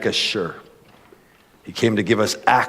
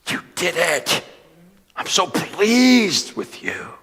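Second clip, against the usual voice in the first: proud; 8 semitones higher, much slower than usual. Retold or imitated. Father praising Jesus.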